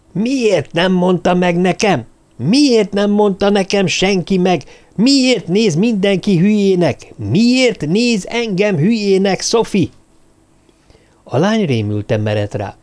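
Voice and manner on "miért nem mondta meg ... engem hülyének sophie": high, slow furious yelling; screaming like a jackal. A narrator's reading. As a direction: rant at her, dragging every word out in rage. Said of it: "Úgy elkezdett ordítani, mint a sakál". (Sophie-ra üvöltő dühös nyomozó, lassan, magasan kiabálva)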